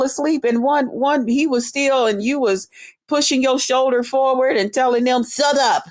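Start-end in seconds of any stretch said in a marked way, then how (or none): 5.2-5.8 mocking toothless mumble. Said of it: "only got them two front teeth".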